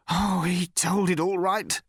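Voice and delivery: savage, high, drawn-out bitterness. A narrator's reading. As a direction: spit it out savagely, pitched high. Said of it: "said the man savagely".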